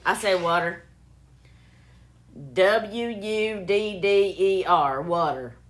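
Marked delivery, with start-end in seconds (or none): 0.4-0.8 "water" said as "wudder"; own "wudder" pronunciation. Real, not acted. Defending how they say it, "water" as "wudder". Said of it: "That's how I say it". 2.5-5.6 slow, loud letters, then "water" as "wudder"; spelled-out own pronunciation. Shown as a demonstration. Defending a lifelong pronunciation, emphatic spelling then "water" as "wudder". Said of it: "That's how I say it".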